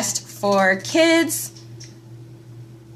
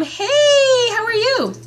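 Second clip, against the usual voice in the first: bright greeting; 12 semitones higher, much slower than usual. Real, not acted. Greeting someone joining live.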